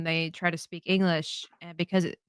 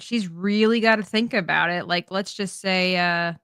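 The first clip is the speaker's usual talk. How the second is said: ordinary talk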